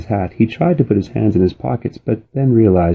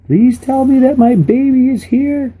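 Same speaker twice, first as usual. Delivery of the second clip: much higher, louder frantic voice; frightened mother crying out; panicked plea; 5 dB louder than usual. Acted. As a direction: beg in a panic. Panicked mother searching for daughter.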